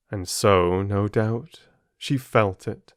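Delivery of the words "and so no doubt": ordinary delivery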